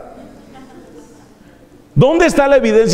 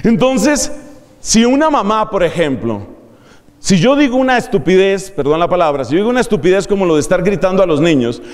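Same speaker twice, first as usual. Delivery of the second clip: ordinary delivery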